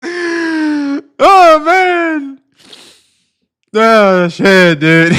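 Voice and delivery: high wail coming down out of a laughing fit; winded by laughter. Real, not acted. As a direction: groan it out, still cracking up.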